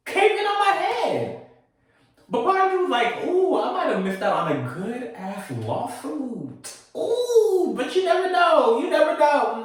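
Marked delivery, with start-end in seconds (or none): 0.1-1.4 disbelief. Retold or imitated. Shocked high slow. 3.3-9.6 playful scheming. Retold or imitated. Mock scheming about lawsuit.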